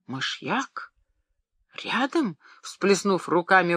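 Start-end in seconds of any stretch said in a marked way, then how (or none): 0.1-2.4 Мышьяк asked softly with a rise, then Рядом much higher in alarm; shocked exclamation. A narrator's reading. Wife hearing about the arsenic, shocked question. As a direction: gasp it out in disbelief. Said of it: "воскликнула госпожа Оме".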